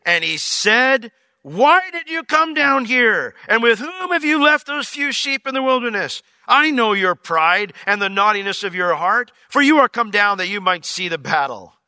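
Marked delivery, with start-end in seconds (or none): 1.4-11.8 livid. Retold or imitated. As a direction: act out an angry older brother's rebuke. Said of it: "He was livid".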